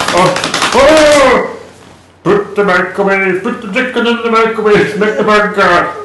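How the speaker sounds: high mock Swedish Chef gibberish; hammed-up Muppet chef voice, laughing. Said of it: "this is the Swedish Chef".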